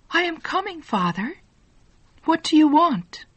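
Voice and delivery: put-on high, warm young woman's answer. A narrator's reading. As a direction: lift the pitch for a young daughter calling back. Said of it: "answered a warm young voice".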